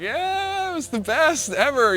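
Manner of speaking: very high mock-cheerful reply; ironic joke. Surfer joking to friend about the pounding, high mock cheer. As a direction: sell the sarcasm.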